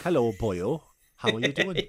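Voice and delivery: loud, hammed-up Welsh singsong; full-on Welsh accent. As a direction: turn the Welsh accent right up. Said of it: "turned the Welsh knob up to eleven".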